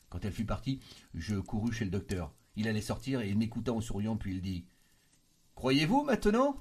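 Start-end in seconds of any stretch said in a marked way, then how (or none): 5.5-6.6 loud, very high challenge; high-pitched, gloating question; triumphant doctor. A narrator's reading.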